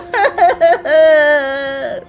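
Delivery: high hammed-up bawling; long theatrical boo-hoo; drawn-out crying; loud wailing sob. Neighbour wailing.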